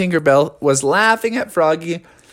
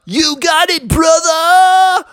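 high, loud, hearty shout; eager eagle; 12 semitones higher, 4 dB louder, slower than usual. Acted. Eddie the Eagle agreeing to Froggy's stunt, high keen shout. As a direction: belt out a raised cartoon yes. Eddie answers, voiced in story.

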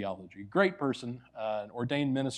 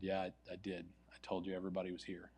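sheepish admission; 5 semitones lower, 13 dB quieter than usual. Retold or imitated.

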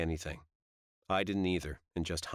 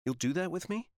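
higher, hopeful question lifting at the end; are-you-serious lift; disbelieving hope; 10 semitones higher than usual. A narrator's reading. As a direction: ask it higher, half disbelieving. Girl's eager question in dialogue.

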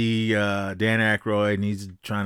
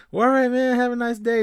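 breezy mock farewell; 5 dB louder than usual. Retold cheerful brush-off to police.

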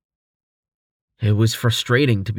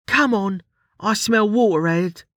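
higher-pitched Ruli voice, gruff and brisk; gruff grunt; 10 semitones higher than usual. A narrator's reading. Ruli urging Quis on, gruff higher voice. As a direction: bark it out like a tough scout. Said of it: "Ruli grunted".

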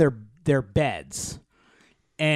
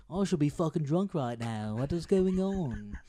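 put-on half-hearted British accent; 4 dB quieter than usual. Man of science reeling at a body swap, restrained British accent. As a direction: hold back a mock British accent. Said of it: "I didn't wanna go too Austin Powers into it".

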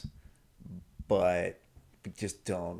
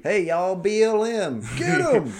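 mock Southern yell; 12 semitones higher, 11 dB louder, slower than usual. Mock Southern protester shout.

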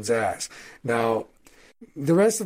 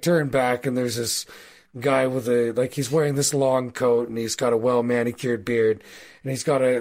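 ordinary talk, nothing marked

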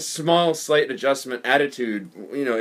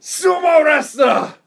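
very high, loud, slow shouted blurt in a put-on voice; blurted exclamation; 13 semitones higher, 6 dB louder, much slower than usual. Retelling the friend's party blunder, high mock shout. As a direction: belt it out like a clueless, delighted foreigner. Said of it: "he points at her and he goes".

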